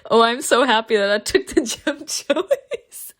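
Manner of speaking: real giggle breaking into the words; laughing delightedly. Real, not acted. Player delighted by the gem choice, laughing. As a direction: talk through helpless laughter.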